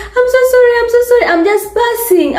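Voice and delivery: high, hurried mock sorry; imitating a panicked man apologising; flustered apology. Passing man apologising to startled woman, high flustered voice.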